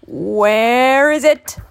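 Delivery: put-on loud old man's holler, drawn out slowly. A narrator's reading. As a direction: holler it and stretch the question. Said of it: "hollered out".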